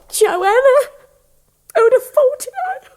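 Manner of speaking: high, laughing delight; joyful laughing surprise. Acted. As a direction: laugh it out in happy disbelief.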